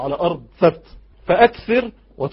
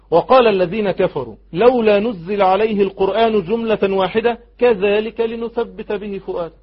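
slow, raised, measured recitation; Quran recitation; 4 semitones higher, 3 dB louder, slower than usual. Acted. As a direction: recite the verse slowly and clearly. Recites a verse. (تلاوة متأنية بصوت مرتفع)

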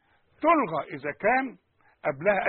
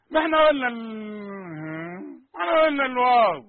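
mock wail; 4 semitones higher, 6 dB louder than usual. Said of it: "teacher exasperated, wailing".

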